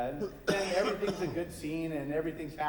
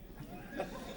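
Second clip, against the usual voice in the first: high breathy witch cackle; 11 dB quieter than usual. Acted. Witch laughing at the mayor, a high cackle.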